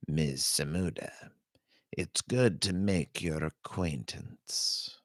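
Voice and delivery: put-on soft, cold old woman, almost papery; a quiet, icy greeting from a trained killer. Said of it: "The woman's voice was cold, soft, almost papery".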